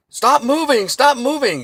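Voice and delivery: high, loud barked cop commands; mock cop yell. Cop shouting at a downed man, high loud yell. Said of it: "still yelling".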